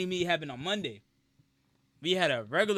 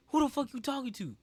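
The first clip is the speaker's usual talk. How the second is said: mock yell, pitched up without much volume; retold yell; 7 semitones higher than usual. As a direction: imitate an indignant coach calling you out. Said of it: "he yelled it out".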